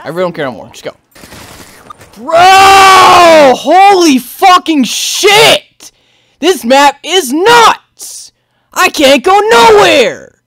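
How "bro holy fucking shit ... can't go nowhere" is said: slow, stretched-out yelling; exasperated yelling. Real, not acted. Player stuck on a nuts map, exasperated yelling. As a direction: yell it with every word drawn out.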